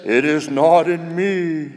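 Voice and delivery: slow, drawn-out sea voice. Acted. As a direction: draw it out as the sea. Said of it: "And the sea says".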